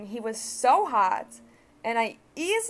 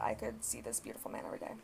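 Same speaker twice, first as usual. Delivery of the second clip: whispered confession; 11 semitones lower, 10 dB quieter, faster than usual. Real, not acted. Admitting to viewers she'd buy a membership, whispered. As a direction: whisper it, much lower and softer. Confessing her car wash plan.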